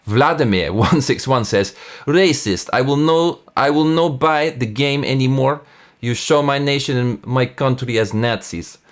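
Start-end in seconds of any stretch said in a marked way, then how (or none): 2.1-8.8 reading the review in a put-on Russian accent, pitched up; hammed-up Russian accent for an angry reviewer; mock Russian accent. Reading an angry Russian gamer's review aloud, mock Russian accent. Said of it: "I'm not sure that's a good Russian accent".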